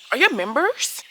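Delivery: imitating the neighbor's aggressive demand; aggressive question. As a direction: confront her with it, loud and hostile. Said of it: "that is aggressive".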